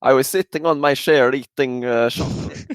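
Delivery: mock Swedish accent, sh for ch. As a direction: put on a Swedish accent, pitched a little up. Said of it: "That's my Swedish impression".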